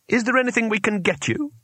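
high, anxious offer of help; high-voiced, concerned enquiry; Two Flower, anxious. A narrator's reading. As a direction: ask with mild, solicitous worry. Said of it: "said Two Flower anxiously".

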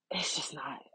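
breathy whisper, trailing off; soft whisper, words giving out. Real, not acted. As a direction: let it fade into a whisper. Trailing off, overcome.

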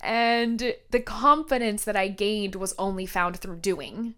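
ordinary talk, nothing marked

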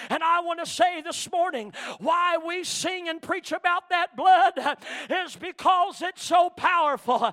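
loud, slow preacher's cry about the blood; preaching at full force. Acted. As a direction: preach it out to the congregation. Preaching climax.

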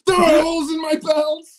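high, shrieking rant at his dad; high-pitched tantrum yelled at dad; furious kid shout. Retold or imitated. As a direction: scream it like a kid losing it at his father. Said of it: "he just goes off on his dad".

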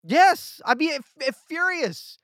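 loud, high, stuttering "furious"; mock fury. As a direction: ham up being outraged. Imagining outrage over no cheers.

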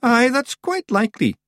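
high, swelling Scottish self-satisfaction; proud. A narrator's reading. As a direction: puff up with pride at the praise. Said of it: "swelling with pride".

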